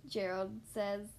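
ordinary delivery

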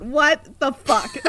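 slow, loud, high disbelief; incredulous. Real, not acted. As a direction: drag it out in disbelief. Reacting to disengage command.

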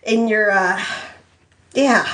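halting, awkward explanation; unsure, searching for each phrase; hesitant. Acted. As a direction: trail into fillers and long pauses. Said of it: "Sam said hesitantly".

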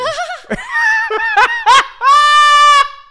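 big shouted laugh, climbing very high; explosive real laugh; loud burst of laughter. Real, not acted. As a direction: howl with laughter at your own joke. Bursts out at his own line.

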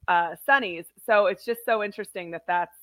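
ordinary talk

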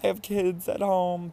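high, drawn-out pleading whine; mock begging. Imitating drunk self pestering strangers for money, mock begging. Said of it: "a sick person".

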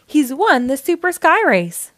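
high loud announcer victory call; triumphant crowing. A narrator's reading. The announcer crows Blaze's win to the crowd, loud and high. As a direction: trumpet the winner to the crowd. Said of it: "crowed the announcer".

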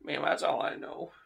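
a hint of a curt suspect. Suspect shuts the door on the detective, curt and soft. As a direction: close the conversation politely but firmly.

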